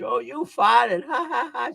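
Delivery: slow, high teasing laughter, imitating her; mocking laugh. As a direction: poke fun at him with a drawn-out laugh. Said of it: "she laughed out loud".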